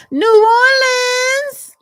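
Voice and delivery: loud, high, stretched-out holler; whooping city shout-out; roll-call shout. Real, not acted. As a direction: belt out the city name, drawn long. Calling out a viewer's city.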